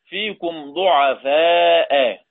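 ضعفاء slow, long alif before the hamza; recited madd. Shown as a demonstration. Teacher reciting the verse ending in Abu Jafar's reading, slowly. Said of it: "امدد مع همز".

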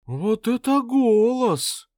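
high, slow melting swoon; breathy vintage purr. Acted. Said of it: "растаяла третья".

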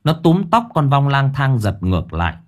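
ordinary delivery

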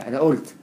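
"قلت" said "olt", with glottal stop; hamza for qaf. Shown as a demonstration. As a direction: say "أنا قلت" as "ana olt", no q. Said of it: "بينطقها همزة".

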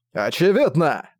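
proud, emphatic one-word reply; proudly. A narrator's reading. Regul cornered by his brother, proud defiance. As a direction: answer with haughty certainty. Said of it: "горделиво ответил Регул".

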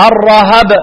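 الرهب as ar-rahab; two open syllables, "ra-hab", in الرهب; Nafi's reading. Shown as a demonstration. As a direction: say الرهب with short a on both ر and ه. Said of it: "نافع".

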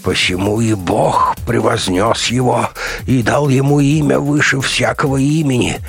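fanatic doctor preaching. A narrator's reading. Crazed doctor chanting scripture to the soldiers, fanatical. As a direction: declaim scripture with mounting fervour.

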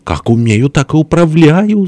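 high, drawn-out wail; tearful protest. A narrator's reading. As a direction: whine it out as if crying. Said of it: "заплакал с той стороны". (плаксиво, нараспев, высоким голосом)

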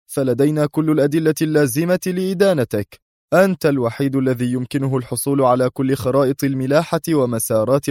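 high, forceful indictment; stern accusation. A narrator's reading. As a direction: lay out the charges severely. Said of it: "فقال الوزير في صرامة".

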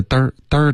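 嘚儿 as "da" curled into an r; imitated r-colouring. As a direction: copy the student's stray r sound on 嘚儿. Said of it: "好像加了儿化音". (模仿儿化音：嘚儿)

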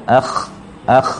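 อัค ending in a rasping, bubbling throat kh; water-boiling kh. Shown as a demonstration. Teacher demonstrates the letter to learners, kh like boiling water. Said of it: "มีเสียงคล้ายๆน้ำเดือดนะครับ".

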